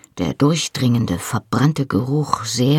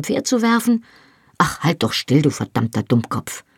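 1 angry scolding; 6 semitones higher than usual. A narrator's reading. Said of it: "ließ meine Angst und meinem Ärger freien Lauf".

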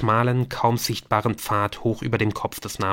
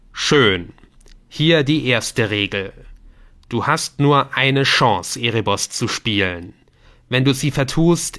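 stern game voice; 5 dB louder than usual. Acted. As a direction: pronounce each rule like a warning. (strenge, langsame Stimme des Spiels)

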